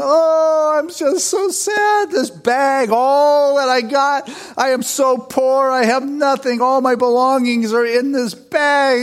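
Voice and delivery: high, slow, whining voice of a crying man; mock-sobbing lament, pitched high and slow; crying beggar. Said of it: "saw a guy in the middle of the road crying".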